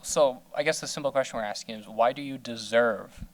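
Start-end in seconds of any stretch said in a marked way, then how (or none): none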